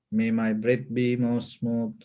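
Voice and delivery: ordinary talk, nothing marked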